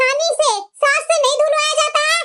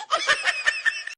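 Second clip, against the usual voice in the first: mocking laugh at the daughter-in-law; 13 semitones higher, 7 dB quieter than usual. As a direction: cackle at her.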